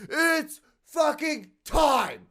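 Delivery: very high, stretched-out strained cry; frenzied shoppers. Acted. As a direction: draw each word out in a mock battle cry. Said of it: "everyone's like".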